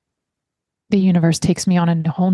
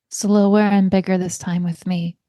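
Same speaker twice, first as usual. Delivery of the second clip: guided breathing. Acted. Leads a breathing exercise.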